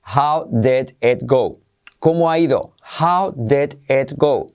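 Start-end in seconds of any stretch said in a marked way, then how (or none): 0.0-1.6 "How did it go?" said slowly with a light "det et"; drawn-out "How did it go?"; slow demo. Shown as a demonstration. Teacher introduces the second phrase slowly. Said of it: "La número dos". 3.0-4.5 "How did it go?" said slowly with a light "det et"; drawn-out "How did it go?"; slow demo. Shown as a demonstration. Teacher introduces the second phrase slowly. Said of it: "La número dos".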